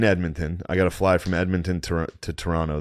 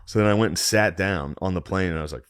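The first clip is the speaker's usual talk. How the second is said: ordinary talk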